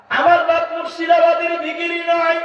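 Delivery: preacher shouting the point home; shouted preaching. Shown as a demonstration. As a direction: thunder it at the crowd. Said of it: "repeated louder".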